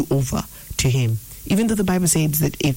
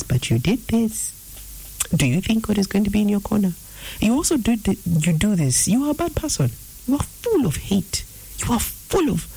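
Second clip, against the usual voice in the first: accusatory; 7 semitones higher than usual. Acted. Hateful voice listing your wrongs to you, accusatory. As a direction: needle them in a high mock-accusing voice. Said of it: "that accusatory tone is not the Holy Spirit".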